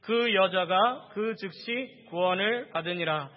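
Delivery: loud, slow, measured unison-style reading; scripture reading aloud. Real, not acted. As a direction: lead the congregation through the verse. Leads congregation in reading scripture.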